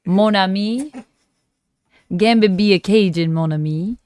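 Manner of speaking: playful attempt at a Cajun accent; hammed-up Cajun character voice; Gambit. Shown as a demonstration. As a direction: lay on a Louisiana Cajun accent. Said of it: "It's hard to do a Cajun accent".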